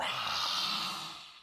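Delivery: long, forceful breathy sigh; big released exhale. Shown as a demonstration. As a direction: let all the air rush out. Demonstrates untamed exhale.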